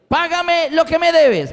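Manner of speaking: high, drawn-out forceful demand; demanding creditor. Acted. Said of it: "le ahogaba, diciéndole".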